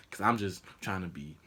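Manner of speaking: normal talking voice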